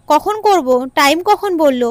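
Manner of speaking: plain reading voice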